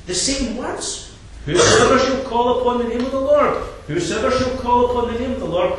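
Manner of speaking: loud, high, rousing preacher's voice; full-force preaching. Acted. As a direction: drive the verses home from the pulpit. Preaching builds on the verses.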